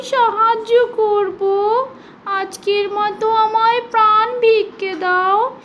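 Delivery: high, slow, tearful squeaky plea; frightened mouse promising to repay the lion; begging mouse. Acted. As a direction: plead for your life as a tiny mouse. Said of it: "কাচুমেচু হয়ে তার প্রাণ ভিক্ষা চাইতে".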